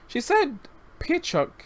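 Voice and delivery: put-on high, eager little boy's voice; young boy. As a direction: voice the rescued child, innocent and chatty. Child voice continues.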